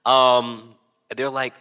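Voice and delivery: ordinary talk, nothing marked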